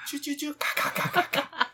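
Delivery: high chirps breaking into squawks; sweet tweets, then crow-like caws; chirps turning to caws. Retold or imitated. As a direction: imitate two mixed bird calls. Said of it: "那两个声音在混杂".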